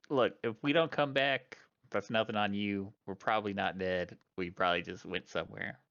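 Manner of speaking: ordinary delivery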